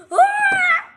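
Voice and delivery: short, high, loud scream; startled yelp. Acted. Confronted by a faker, startled high yelp. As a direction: yelp in sudden alarm.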